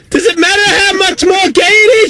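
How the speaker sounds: very high-pitched, indignant outburst; exasperated, voice shooting way up; outraged comeback. Real, not acted. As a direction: screech it at an idiot caller. Outraged comeback to caller's silly question.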